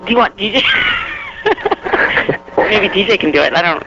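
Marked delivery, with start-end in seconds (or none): 0.7-2.5 long, high shouted laughter; real fit of the giggles. Real, not acted. Guest suggests DJ do it, laughs hard. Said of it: "now that you guys have me laughing".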